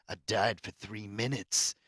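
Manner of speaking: slow, deadpan imitation of the character; lightly voiced tough agent, understated; mock quote. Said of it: "she's like".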